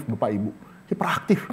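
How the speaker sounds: hushed confiding whisper. Real, not acted. Preacher whispering to the congregation about a child. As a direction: lean in and whisper it.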